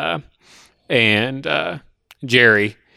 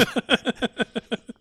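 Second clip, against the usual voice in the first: high laugh; 12 semitones higher, 8 dB quieter than usual. Real, not acted. Laughing at own story.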